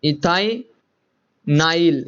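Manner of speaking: very slow, stretching every syllable of इथाइनाइल; drawn-out answer. Shown as a demonstration. Teacher to his class, revealing the name very slowly. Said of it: "क्या बनेगा बच्चों".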